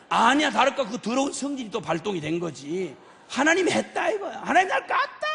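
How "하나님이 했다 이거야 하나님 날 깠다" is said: high, hammed-up complaint; mock indignant accusation. Jonah blaming God for the withered vine, mock indignant. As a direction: flare up and blame heaven, high-pitched.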